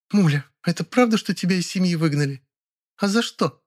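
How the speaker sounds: high, pressing female voice; insistent woman. A narrator's reading. Zina questions Mulya about family, insistent. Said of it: "Она прямо напирала на меня".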